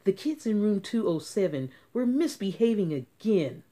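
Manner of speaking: ordinary delivery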